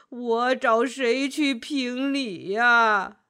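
very slow, heavy lament; aggrieved, plodding old animal voice; weary old ox. Acted. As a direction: drag out each phrase like a worn-out old ox. Old ox complains.

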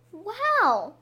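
high, bright gasp of wonder; breathless delight at the house; amazed wow. Acted. Children see candy house.

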